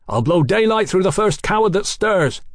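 level pistols and warn the crowd; armed threat. A narrator's reading.

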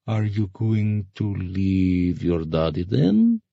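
slow drawl climbing into the question; taunting ferryman. Acted. Ferryman asking about her daddy, taunting drawl. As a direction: stretch the words out to needle her.

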